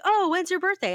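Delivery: high, bright mock staff voice; chirpy theme-park employee; cheerful cast member.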